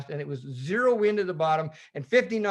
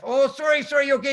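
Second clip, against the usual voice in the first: raised, higher apologetic call; loud radio call; 8 semitones higher, 6 dB louder than usual. Retold or imitated. Retold radio call to boat.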